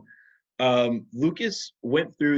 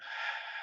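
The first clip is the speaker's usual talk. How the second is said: long soft sigh through the mouth; demo slow exhale; 14 dB quieter than usual. Shown as a demonstration. Teacher modelling calm-down breathing, slow sigh. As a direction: breathe it all out slowly. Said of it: "out your mouth".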